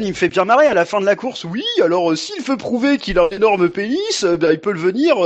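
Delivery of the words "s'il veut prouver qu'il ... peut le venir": normal talking voice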